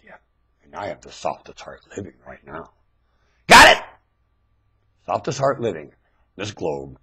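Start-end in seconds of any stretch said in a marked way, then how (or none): 3.5-3.8 high, loud challenge; loud demand. Real, not acted. Ranter demands agreement, loud challenge. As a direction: jab the question at them.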